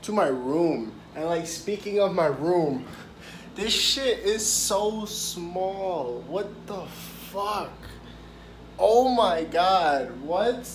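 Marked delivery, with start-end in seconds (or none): 3.5-10.7 shocked. Real, not acted. Shocked by tiny hotel room.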